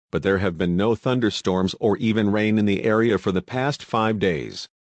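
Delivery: usual speaking voice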